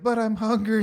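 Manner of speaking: mock kid whine; whiny little kid. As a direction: play a small boy complaining he's hungry. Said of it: "Little Johnny's like".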